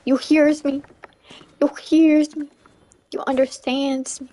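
ordinary talk

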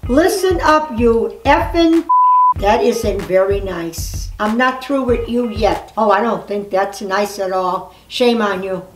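ordinary talk

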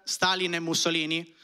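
ordinary talk

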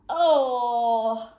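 loud, drawn-out "oh"; appalled cry. A narrator's reading. Duck sees what rabbit produced, appalled cry. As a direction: cry out in dismay. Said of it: "cried Dora".

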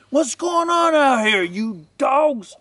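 high, put-on holler at the dogs; angry master. Master storming out at noisy dogs, hollering.

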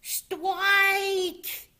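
put-on very high, squealed childlike "stwike"; little Polly yelling. A narrator's reading. Polly's ball touches the pin, high babyish yell. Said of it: "yelled Polly".

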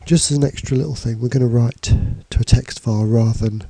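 usual speaking voice

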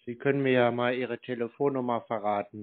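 ordinary delivery

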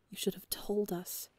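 hushed, whispered reproach; soft and sad. A narrator's reading. Nikki gently reproaching Phil, a sad whisper. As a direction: breathe it out, disappointed. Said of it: "Nikki says softly".

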